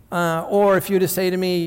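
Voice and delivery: ordinary talk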